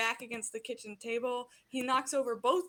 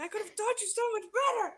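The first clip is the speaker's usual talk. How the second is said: whining villain father; 9 semitones higher, faster than usual. Acted. Villain father lamenting to Viper, high and theatrical. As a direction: moan it like a wounded tyrant. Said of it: "He's like".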